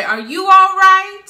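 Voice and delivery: shouted high and loud; loud checking call. Retold or imitated. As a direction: call out anxiously to a far room.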